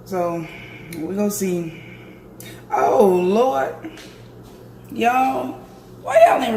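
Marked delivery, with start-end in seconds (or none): none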